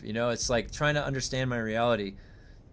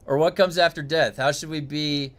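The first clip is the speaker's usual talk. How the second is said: ordinary talk, nothing marked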